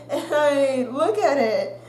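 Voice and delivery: ordinary talk, nothing marked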